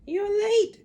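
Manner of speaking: put-on high, loud old witchy snap; snapping Grandma. A narrator's reading. Nasty grandma snapping at George. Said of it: "she snapped".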